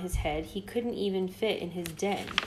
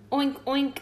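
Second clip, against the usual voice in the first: high oinking squeal; squealing piglet; 6 semitones higher than usual. A narrator's reading. Piglet greeting Hedgie, high squealing oink. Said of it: "the piglet squealed".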